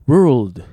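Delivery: very loud "World" for repeating; pronunciation drill. Shown as a demonstration. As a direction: punch out "World" as a model to copy. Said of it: "発音練習".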